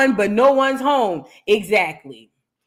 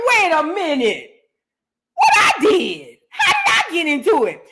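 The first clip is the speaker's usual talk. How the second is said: mock shocked protest; 4 semitones higher than usual. Replaying her shocked protest.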